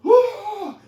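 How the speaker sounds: sharp, high, loud yelp like a spooked dog; a dog's sudden high yelp of alarm; startled dog yelp. Retold or imitated. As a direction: bark out a frightened yip. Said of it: "and the dog goes".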